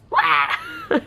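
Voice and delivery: very high squeaked cry; sudden squeaky yelp; squeaky squeal. Real, not acted. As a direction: squeal it out high, half laughing. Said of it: "Squeaky voice".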